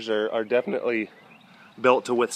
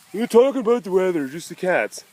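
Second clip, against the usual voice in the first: higher, quicker mock voice of a viewer; lightly mocking viewer; 6 semitones higher than usual. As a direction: play back what viewers ask.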